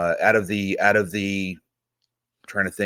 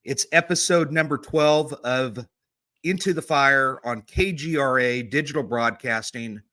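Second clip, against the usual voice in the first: high, bright, slowed radio welcome; radio host voice; 7 semitones higher than usual. Acted. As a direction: open the show like a pro announcer. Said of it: "You sound like a real radio person".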